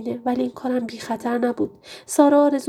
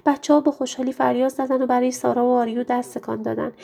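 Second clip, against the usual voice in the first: plain reading voice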